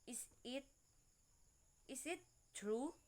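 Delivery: questioning, pitch climbing on "true"; soft question lifting up on "true"; rising intonation. Shown as a demonstration. Student reading a rising example, questioning lift on "true". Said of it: "the example of rising intonation".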